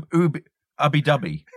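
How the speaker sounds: "oob, oob, ubby dubby" with "ub" slipped in; Ubbi Dubbi. Shown as a demonstration. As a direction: bounce out the Ubbi Dubbi sounds. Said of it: "you insert ob or ub".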